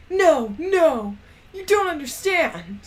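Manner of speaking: mock begging, half-hearted; a hint of a desperate boyfriend; pleading. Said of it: "Draco pleaded".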